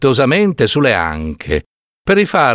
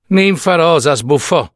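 usual speaking voice

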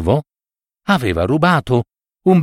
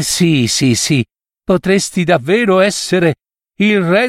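higher, sighing fox voice; sly fox, mock pity; 5 semitones higher than usual. Fox sighing at the crow below the tree, mock pity. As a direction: fake sympathy with theatrical sighs.

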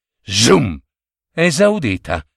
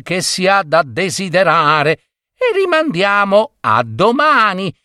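lightly voiced, higher-pitched wife; prudent wife; 7 semitones higher than usual. A narrator's reading. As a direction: gently coax a husband to be patient. Narrator voices the wife advising patience. (moglie prudente, tono un po' più acuto)

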